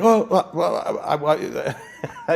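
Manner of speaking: mock a man too stunned to speak; tongue-tied stammer. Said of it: "I started talking stupid because I couldn't talk".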